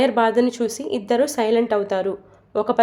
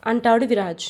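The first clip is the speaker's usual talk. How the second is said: ordinary delivery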